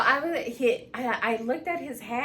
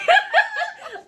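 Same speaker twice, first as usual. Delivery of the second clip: high, loud burst of laughter; laughing; 8 dB louder than usual. Real, not acted. Mother cracking up at her own story, laughing.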